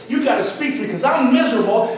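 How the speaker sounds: usual speaking voice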